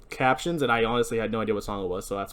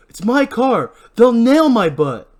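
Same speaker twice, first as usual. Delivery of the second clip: loud, high, drawn-out complaint; agitated, raised-voice outburst; panicked anger; 10 semitones higher, 10 dB louder, slower than usual. Acted. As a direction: protest loudly for your own skin. Barry panics about blame.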